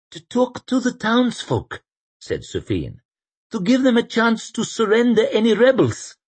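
put-on earnest young priest. Sufin asking the general, high and earnest. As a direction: plead the case up high.

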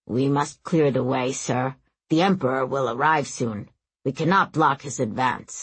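low, firm servant's voice; glaring guide. A narrator's reading. Guide urges Aram to clear the way, glaring, low voice. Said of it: "Their guide was glaring at him".

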